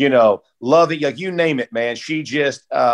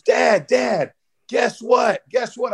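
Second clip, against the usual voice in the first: put-on high, eager kid calling Dad; excited little son; 8 semitones higher, slower than usual. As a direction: play a small boy bursting with news. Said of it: "And he goes".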